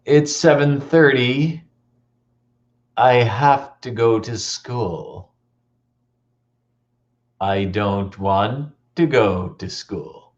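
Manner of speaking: slow, dragging, mopey moan; gloomy dread.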